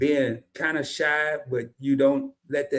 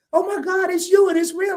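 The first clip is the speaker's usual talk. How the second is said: excited fan; 13 semitones higher than usual. Retold or imitated. Fan recognizes him, retold.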